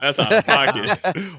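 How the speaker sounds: said through laughter, slowed; laughing. Real, not acted. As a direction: crack up while saying it.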